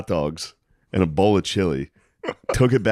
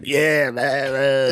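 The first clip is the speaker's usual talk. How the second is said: put-on lightly done southern accent, raspy smoker drawl implied; a hint of a southern chain-smoker; 5 semitones higher, much slower than usual. Imitating a heckling lady in a southern accent. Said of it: "a weird cigarette southern accent".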